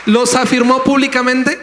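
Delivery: high, loud pulpit question rising on "públicamente"; fiery question that lifts hard on "públicamente"; loud rhetorical question. Real, not acted. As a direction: shout "¿Los afirmó públicamente?" up to the back row. Preacher repeats rhetorical question.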